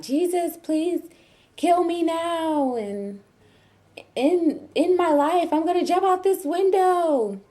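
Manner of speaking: high, drawn-out mock wail of agony; retold screaming. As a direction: act out screaming through contractions. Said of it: "I was screaming out".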